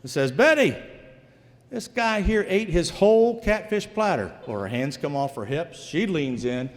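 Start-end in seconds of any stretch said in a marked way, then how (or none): 0.3-4.3 high yell across to the kitchen; calling to wife. Retold or imitated. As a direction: call out to Betty in the kitchen, pitched high. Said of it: "He yells at his wife".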